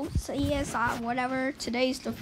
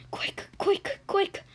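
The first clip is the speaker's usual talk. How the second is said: whispered urging; 6 semitones higher, slower than usual. Real, not acted.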